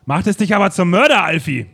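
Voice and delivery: loud, high, pointed accusation; spring the accusation on Alfie; accusing question. Acted. Detective confronts Alfie in the murder case, loud accusing.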